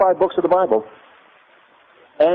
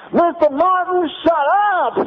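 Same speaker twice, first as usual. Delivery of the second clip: screamed; 12 semitones higher than usual. Retold or imitated. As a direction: scream it like an angry lecturer. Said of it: "he screamed at me from the lectern".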